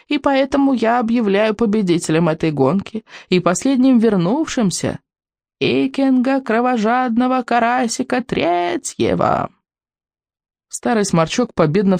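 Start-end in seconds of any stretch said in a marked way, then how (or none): 0.1-9.5 put-on quavering old man voice. A narrator's reading. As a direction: announce the winner like a frail elder. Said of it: "своим дряхлым дребезжащим голосом". (дряхлый дребезжащий голос)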